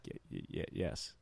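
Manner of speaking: breathless stammered yes, barely voiced; nervous stammer. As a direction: stutter it out, too rattled to speak up. Scared kid answers the teacher.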